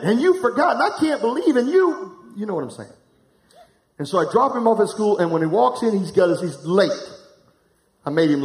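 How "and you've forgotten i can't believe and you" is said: high-pitched, hammed-up telling-off; mock scolding. Father lets his son have it over a forgotten belt, mock scolding. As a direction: sputter it out in comic outrage, voice climbing. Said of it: "I'm letting him have it".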